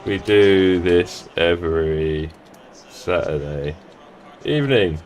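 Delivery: low, slow, drawn-out words; typing aloud. Real, not acted. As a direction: speak at the speed of your typing. Typing a message while saying it.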